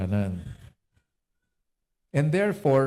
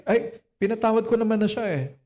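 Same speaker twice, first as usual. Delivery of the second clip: high sing-song imitation of someone who claims to forgive; lightly voiced, airy claim of forgiveness; mock forgiver; 9 semitones higher than usual. Pastor mimicking people who say they forgave, high and airy.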